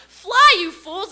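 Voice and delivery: piercing high yell falling away; Gandalf's desperate cry. Retold or imitated. Falling wizard calling up to Frodo and Sam, shrill yell. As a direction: scream it while slipping off the edge. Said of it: "he looks up at Frodo and Sam and he goes".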